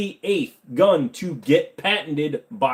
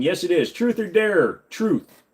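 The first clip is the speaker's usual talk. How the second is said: usual speaking voice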